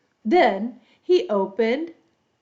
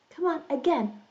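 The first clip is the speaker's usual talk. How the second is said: ordinary talk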